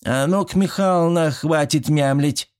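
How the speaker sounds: put-on a bossy old woman giving a command; stern old woman's order. A narrator's reading. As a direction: order her sternly like a village granny. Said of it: "сурово сдвинула брови и приказала".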